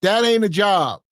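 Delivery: ordinary delivery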